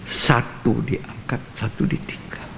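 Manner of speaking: hushed solemn warning. Acted. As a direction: drop to a near whisper.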